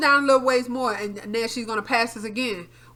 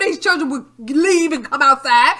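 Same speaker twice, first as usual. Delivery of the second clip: ordinary delivery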